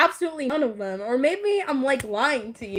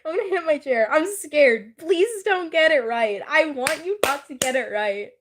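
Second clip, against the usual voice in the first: ordinary talk, nothing marked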